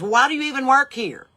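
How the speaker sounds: mock outraged customer; indignant demand. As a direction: scold her like a useless clerk. Woman scolding her.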